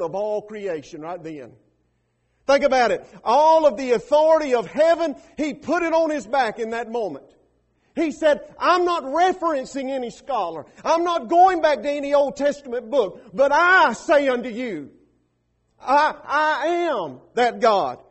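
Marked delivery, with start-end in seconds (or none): 2.5-14.9 loud, driving preacher climax; preaching at full force. Acted. 15.8-18.0 slow, loud, every word of "I, I am that God" pressed hard; emphatic I. Acted. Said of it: "that I is emphatic".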